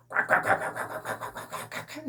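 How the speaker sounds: high breathless imitated pig squeal. Preacher mimicking a pig at slaughter, high squeal. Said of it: "돼지 멱다는 소리".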